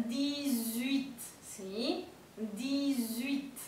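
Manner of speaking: "dix-huit" with the X said as a voiced Z; liaison dix-huit. Shown as a demonstration. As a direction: link "dix-huit" as "di-zuit". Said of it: "pasa a pronunciarse como una Z".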